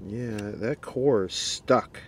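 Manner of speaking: ordinary delivery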